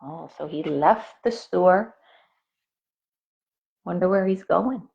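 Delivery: usual speaking voice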